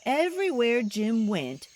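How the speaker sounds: ordinary delivery, nothing marked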